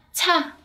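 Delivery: "Cha" high, with a puff of air on CH; bật hơi. Shown as a demonstration. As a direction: say "Cha" with a strongly aspirated opening consonant. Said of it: "các bạn phải bật hơi ra". (đọc "Cha" cao giọng, bật hơi ở âm "ch")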